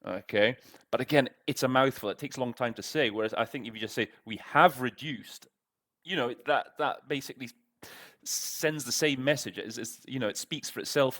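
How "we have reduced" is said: "have" hit clearly, said slowly; stress on have. Shown as a demonstration. Teacher demonstrating to translators, stress on "have". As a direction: give "have" the weight in "We have reduced". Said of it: "just by putting that emphasis on the have".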